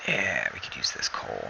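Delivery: soft whispered aside to himself; hushed voiceless whisper. Real, not acted. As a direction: breathe it out under his breath. Whispering to himself mid-game.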